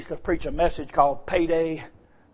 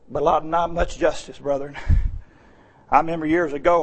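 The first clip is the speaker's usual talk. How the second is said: normal talking voice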